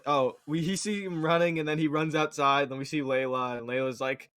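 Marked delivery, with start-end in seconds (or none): none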